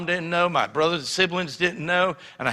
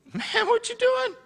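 much higher startled cry; shocked friend. Retold or imitated. Friend seeing cigarettes thrown away, high startled cry. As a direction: squeak it out in disbelief.

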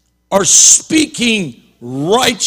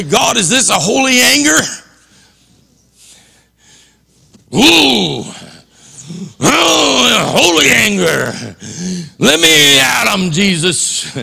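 mock eager anger; 3 semitones higher than usual. Preacher voicing his own eager anger.

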